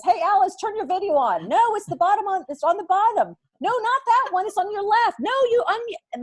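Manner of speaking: high, loud overlapping calls to Alice; frantic group yelling help; calling out instructions. Retold or imitated. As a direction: shout directions across the Zoom call. Chaotic Zoom instructions acted.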